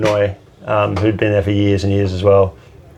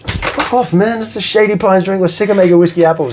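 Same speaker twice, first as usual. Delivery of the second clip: high exasperated put-on voice for Benoit; high, fed-up coworker griping about whiskey apples; mock coworker; 11 semitones higher than usual. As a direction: squeak it out like a fed-up bartender. Coworker voiced in retold story.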